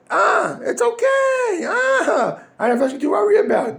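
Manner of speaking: a hammed-up carefree sinner; careless shrug. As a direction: brush off the warning like nothing matters. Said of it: "But he says".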